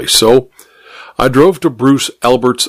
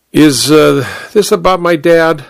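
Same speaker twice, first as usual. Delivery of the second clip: put-on drunk slurring; 5 semitones higher than usual. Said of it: "his voice slurred enough to show he was drunk".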